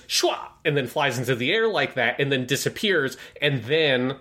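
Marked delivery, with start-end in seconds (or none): 0.1-0.4 very high sharp "Shwa!" cry; Ultraman cry. Retold or imitated. Imitating Ultraman as he flies off, high heroic cry. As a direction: yelp "Shwa!" like a hero taking off. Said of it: "then he goes".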